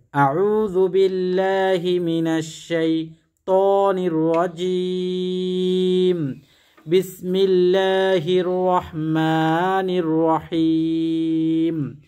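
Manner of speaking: each Arabic syllable drawn out very slowly, a measured chant; slow careful recitation. Shown as a demonstration. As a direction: recite the prayer slowly, syllable by syllable, as a model to copy. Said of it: "তাওয়াউজ পাঠ করবেন".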